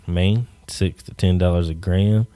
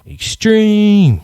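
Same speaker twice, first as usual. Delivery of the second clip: high, loud extreme-sports announcer shout; mock hype; 14 semitones higher, 7 dB louder than usual. Joking about kids licking handrails, mock announcer yell. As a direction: blast it like a cheesy X Games promo.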